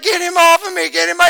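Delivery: high, loud imitation of a boy screaming in pain; retold panicked yelling. Pastor imitating his son begging for help, high panicked scream. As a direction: shriek for help like the steer's still on his foot. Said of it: "he's like".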